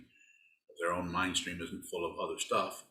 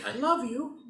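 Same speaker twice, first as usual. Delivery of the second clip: mock sweet; slower than usual. Mock saying it to evil people.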